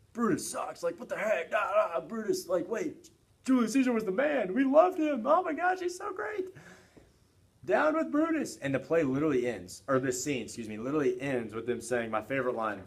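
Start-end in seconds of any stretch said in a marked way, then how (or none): 0.1-8.6 much higher and louder, building to a shriek; outraged crowd. Retold or imitated. Teacher voicing the mob turning on Brutus, rising to a shrill shout. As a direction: work yourselves into a frenzy against Brutus.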